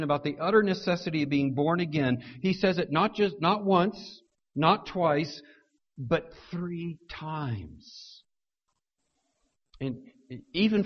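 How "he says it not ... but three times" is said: slows right down on but three times; drawn-out but three times; counting emphasis. Shown as a demonstration. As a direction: build then stretch out three times. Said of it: "the degree of emphasis".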